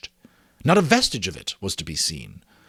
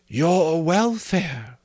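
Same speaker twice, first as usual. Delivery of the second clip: slow, high spirit voice; calm ghost; 6 semitones higher, much slower than usual. A narrator's reading. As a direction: let each word hang. Ghost answers.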